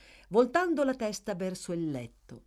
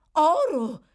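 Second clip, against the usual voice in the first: much higher, louder eager gasp; the matron hungrily echoing; greedy echo; 11 semitones higher, 11 dB louder than usual. Acted. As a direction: grab the word greedily. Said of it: "fece eco la direttrice, chinandosi avidamente".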